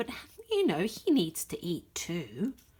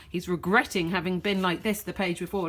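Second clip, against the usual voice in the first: ordinary delivery, nothing marked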